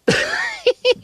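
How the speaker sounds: shrieking giggle; high squealing laugh. Real, not acted. Laughing at her own line.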